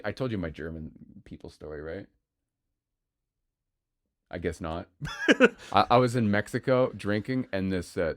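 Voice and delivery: ordinary delivery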